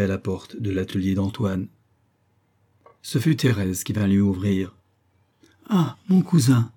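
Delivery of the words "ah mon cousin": higher, slow delighted exclamation; joyful greeting. A narrator's reading. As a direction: greet a welcome cousin with drawn-out joy. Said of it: "dit-elle joyeusement". (salutation joyeuse)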